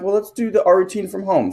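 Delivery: put-on tender to a child. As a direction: gently coax a sleepy boy along. Dad soothing son.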